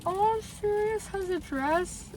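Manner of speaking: ordinary talk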